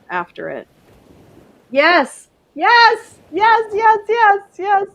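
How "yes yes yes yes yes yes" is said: higher, louder excited yeses. Real, not acted. Recognizing the hotel district, much higher and louder. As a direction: burst out with joyful recognition.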